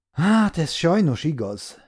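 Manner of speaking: slow, sighing and pained. A narrator's reading. Bő admitting his failure to the lawyer, pained sigh. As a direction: let it out with a painful sigh. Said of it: "sóhajtott föl Bő fájdalmasan".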